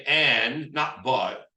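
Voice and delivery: "and" drawn out and loud, against "but"; contrastive stress. Shown as a demonstration. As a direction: lean long and hard on "and". Coach contrasts two words in script.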